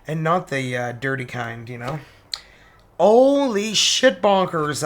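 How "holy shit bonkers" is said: surprised outburst. Real, not acted. Reader shocked by the next card.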